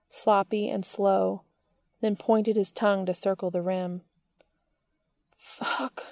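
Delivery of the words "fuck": breathy whimpered curse; whimpering. Acted. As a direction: let it slip out as a whimper. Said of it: "Dean whimpered".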